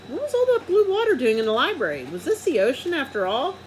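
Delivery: amazed. A narrator's reading. Narrator marvels, much higher.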